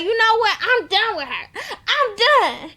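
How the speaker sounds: high, loud fed-up outburst; pissed off. Retold or imitated. Lexi, pissed off at Mo, high and loud before storming out. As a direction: snap it out high and done with her. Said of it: "Lexi is basically getting pissed off".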